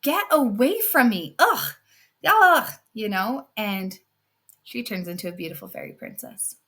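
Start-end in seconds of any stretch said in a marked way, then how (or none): 0.0-2.7 loud, high revolted brat; loud high ugh of disgust. Acted. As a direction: push the gross old woman away. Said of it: "the not so nice sister says".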